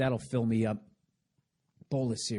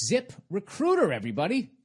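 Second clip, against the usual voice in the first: ad read; 9 semitones higher, 3 dB louder than usual. Acted. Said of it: "ad read opener".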